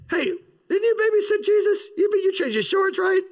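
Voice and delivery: high-pitched mock neighbour voice; incredulous townsperson. Townsperson recalling babysitting Jesus, high mock-incredulous voice. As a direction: pipe up in comic disbelief.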